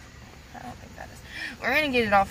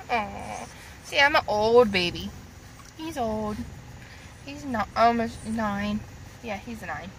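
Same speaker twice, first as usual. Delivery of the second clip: ordinary talk, nothing marked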